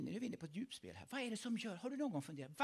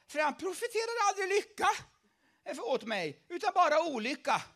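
higher, loud whining grievance; loud complaint; 11 semitones higher, 10 dB louder than usual. Acted. Ahab complaining about the prophet, high and loud. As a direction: protest like a sulking king.

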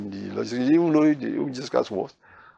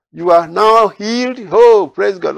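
high, loud, slow jubilation; joyful praise; 8 semitones higher, 8 dB louder, slower than usual. Pretend. Imagined crowd rejoicing over the healed man, loud and high. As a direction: celebrate like an overjoyed crowd.

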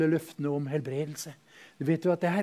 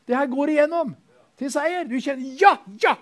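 much higher and louder, ecstatic; ecstatic preaching; 10 semitones higher, 9 dB louder than usual. Acted. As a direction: swept up in joyful faith. Preacher acting ecstatic faith.

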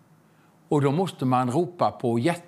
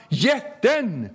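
father shouting; 7 semitones higher than usual. Retold or imitated. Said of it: "Då skriker pappa".

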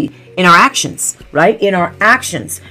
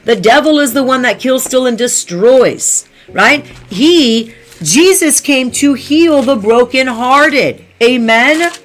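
raised, higher fervent preaching; preaching at full force; 5 semitones higher than usual. Acted. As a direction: lift the room as the preacher.

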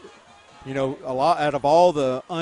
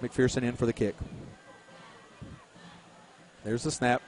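ordinary talk

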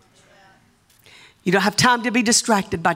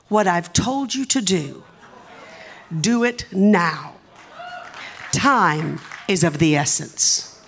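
solemn quoted message; 2 semitones lower, slower than usual. Retold or imitated. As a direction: deliver the message slowly and gravely. Quoting the prophetic text slowly.

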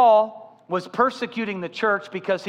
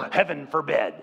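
mock horror; 5 semitones lower than usual. Mock gasp at breaking rules.

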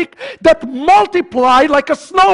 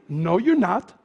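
low, soft reply after the hype; deflating aside; 5 semitones lower, 6 dB quieter than usual. Real, not acted. Preacher answering the eager congregant, deflating aside. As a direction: drop down and puncture it.

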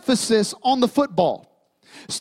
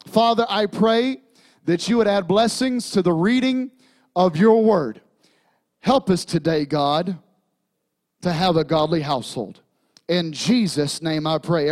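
prayer; 5 semitones lower than usual. Acted. Pastor prays over the reading, low and hushed.

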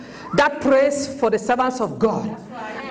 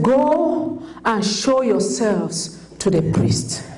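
lightly voiced Jesus; 7 semitones lower, slower than usual. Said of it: "And Jesus looked at them and said".

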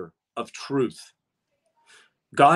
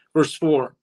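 ordinary delivery

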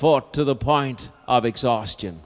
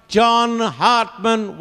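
high, drawn-out call; crying out; 9 semitones higher, 3 dB louder, much slower than usual. Acted. Dying men echoing the father's call, crying out. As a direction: send the name ringing over the field. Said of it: "from dying men came the cry".

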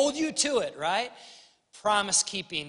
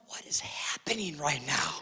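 whispered under the breath; stunned whisper; 3 semitones lower, 4 dB quieter than usual. Retold or imitated. Dad whispering to himself as his son cries. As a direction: mutter it in disbelief, whispered. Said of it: "And I'm going".

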